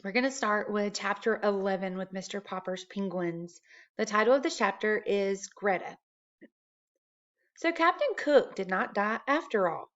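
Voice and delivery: ordinary delivery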